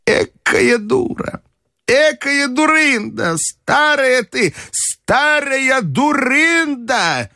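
put-on very high, slow, drawn-out old-lady exclamations; self-scolding outbursts. A narrator's reading. Grandmother cursing herself on the ride back, high drawn-out outbursts. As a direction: berate yourself, dragging out each insult high. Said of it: "у неё начали вырываться восклицания".

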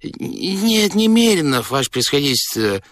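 high-pitched, hesitant reply; guilty steward. A narrator's reading. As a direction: answer the master apologetically, stumbling at first. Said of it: "виновато вздохнул".

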